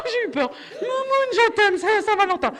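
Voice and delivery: loud, high mock blokey excitement; hammed-up guy caught out by his girlfriend; excited guy. Man excited about cats then apologising to his girlfriend, loud. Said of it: "Les mecs, ils font".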